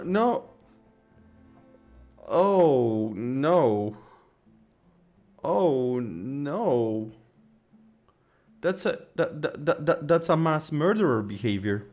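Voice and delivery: normal talking voice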